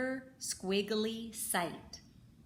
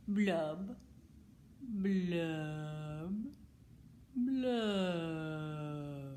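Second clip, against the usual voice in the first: glum fish blubs; much slower than usual. Acted. Fish's glum bubbling refrain.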